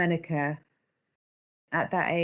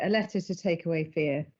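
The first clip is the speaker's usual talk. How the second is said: ordinary talk, nothing marked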